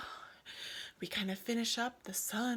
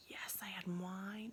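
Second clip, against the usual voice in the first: hushed confessional whisper; slow whispered admission; whispered aside; 9 dB quieter than usual. Real, not acted. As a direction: let them in on a little secret. Confessing aside about pregnancy wine.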